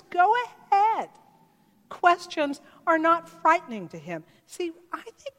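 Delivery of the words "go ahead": "Go ahead" much higher and louder, bursting open; emphatic invitation. Retold or imitated. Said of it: "He says".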